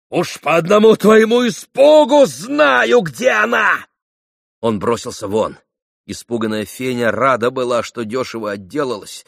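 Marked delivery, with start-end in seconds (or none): 0.1-3.9 high, loud triumphant rage; furious shout. A narrator's reading. Mitya reading Fenya's fright as proof, furious shout. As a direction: shout it down at her. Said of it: "вскричал Митя".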